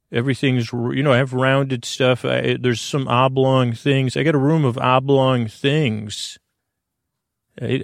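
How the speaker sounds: ordinary talk, nothing marked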